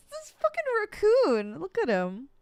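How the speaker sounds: delighted squeal, voice jumping up; high squeal of delight. Real, not acted. Streamer delighted by the game raccoon, squealing high.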